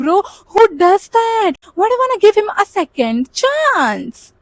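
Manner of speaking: high, drawn-out indignant protest; outraged disbelief. Retold or imitated. Complaining about HR to the listener, high slow outrage. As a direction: plead slowly, appalled. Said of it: "And I was like".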